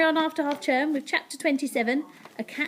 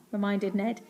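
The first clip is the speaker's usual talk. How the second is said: usual speaking voice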